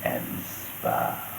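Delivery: slow, grand announcer read, dropping low at the end; mock radio voice; hammed-up radio announcer. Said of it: "I just like to say it because I got a face that was made for radio".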